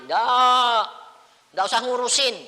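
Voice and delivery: high, loud brush-off; telling his father not to bother; loud dismissal. Retold or imitated. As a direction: wave it away, loud and high.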